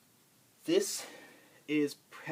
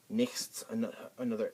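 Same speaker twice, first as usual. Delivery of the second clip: ordinary delivery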